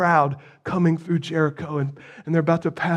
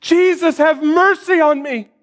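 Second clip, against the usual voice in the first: high pleading call; a blind man's desperate high cry; 9 semitones higher than usual. Acted. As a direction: beg him across the crowd. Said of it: "Can you feel his desperation?".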